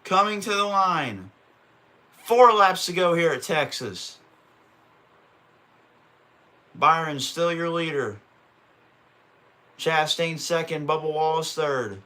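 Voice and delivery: brisk announcer calling the laps; race play-by-play. Acted. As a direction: call it like a TV race caller.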